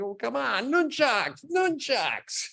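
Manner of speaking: high, whiny pleading; hammed-up whine; mock pleading. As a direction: beg like a kid denied a toy. Pleading to use nunchucks.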